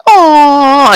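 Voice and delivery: high, drawn-out mock childish aww; kids' disappointed aww. Host mocks kids reacting to the Fiend's loss, high drawn-out aww. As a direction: imitate a crowd of let-down kids. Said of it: "the kids will be like".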